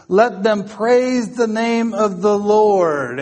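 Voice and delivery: high, ringing, rhythmic litany of praise; exultant scripture proclamation. Real, not acted. Preacher calling all creation to praise, exultant declaiming. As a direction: proclaim each "praise him" high, stretch the last line.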